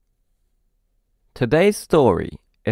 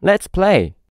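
lightly voiced friend, higher and bright; a child's friend inviting him out to play; friend calling to play; 9 semitones higher than usual. Narrator voicing the friend inviting the child to play, higher. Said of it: "He said".

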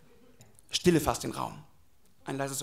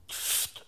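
soft, voiceless shushing; hushing psst; 12 dB quieter than usual. Acted. Devil silences whoever defies him, hushing psst. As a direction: hush them with a hiss. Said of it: "sag ich nur".

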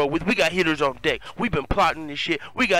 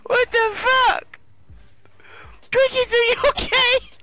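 very high mock-hysterical squeal; freaking out; 3 dB louder than usual. [name] checking on his hurt friend, high panicked squeal. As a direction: squeal it in hammed-up alarm. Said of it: "He's freaking out".

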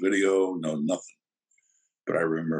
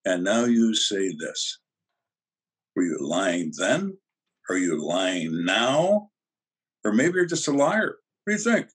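accusing interrogator; 2 semitones higher than usual. Retold or imitated. Detective confronting a suspect caught in a lie, accusing. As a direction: press each accusation, then pitch up tauntingly.

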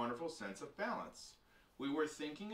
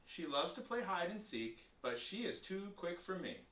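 usual speaking voice

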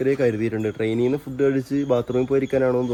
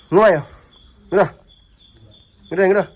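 calling over; 7 semitones higher, 4 dB louder than usual. Real, not acted. Beckoning a child over. (കുട്ടിയെ ഇങ്ങോട്ട് വിളിക്കുന്നു)

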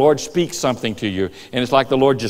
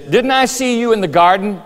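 high, raised mock accusation; accusing question; 7 semitones higher than usual. Bystander confronting Peter in the garden, accusing. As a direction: challenge him, pitched up.

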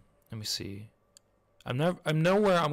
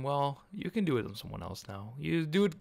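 ordinary delivery

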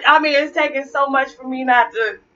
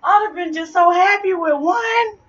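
whiny near-crying; 7 semitones higher than usual. Real, not acted. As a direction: moan it like you're about to cry. Second lemon ordeal.

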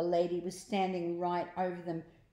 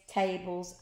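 usual speaking voice

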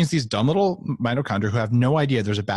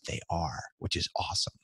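whisper; 12 dB quieter than usual. Real, not acted. Confiding aside about cells dying.

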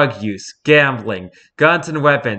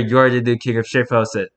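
normal talking voice

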